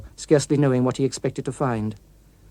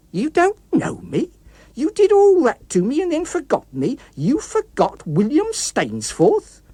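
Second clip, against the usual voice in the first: much higher, slow outraged disbelief; incredulous fury; 12 semitones higher, 4 dB louder, slower than usual. Acted. The villain to the author who forgot him, outraged disbelief. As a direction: hurl the name at him.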